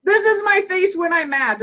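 lightly acted teaching parent; demonstrating mad face. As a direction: show the kid what mad looks like. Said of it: "like, say".

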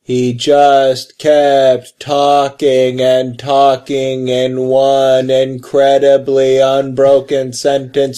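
very slow, measured recital; Picard. Retold or imitated. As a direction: draw out every word in an endless unbroken speech. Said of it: "Like, Picard's, like, trying to make a point".

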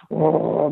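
drawn-out mock warrior growl; imitated growl. Little girl stalking like a warrior, mimicked growl. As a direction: growl like a toddler playing warrior. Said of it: "making a growling sound".

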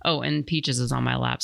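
normal talking voice

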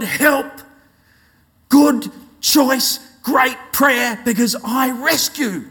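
high, ringing preacher's voice, pressing every phrase; fervent preaching. Acted. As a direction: preach it out to the congregation at full pitch. Opening preached at high pitch.

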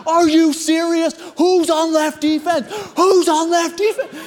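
high, incredulous yelling; intense. Retold or imitated. Intense player demanding who covers left defense, freaking out. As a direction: holler it like an over-intense player. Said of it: "Like, I'm intense".

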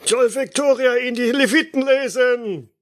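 high-pitched cheering bystander; crowd heckler. Acted. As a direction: shout approval from the crowd. Heckler calls out.

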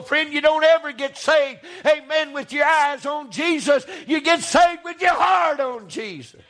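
ordinary delivery

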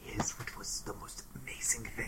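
hushed, awed whisper. Acted. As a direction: whisper to build suspense. Character builds suspense.

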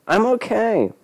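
very high whiny insistence; exasperated insistence. A narrator's reading. Boy answers Johnny's repeated question, high whiny insistence. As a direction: squeal the reassurance way up high.

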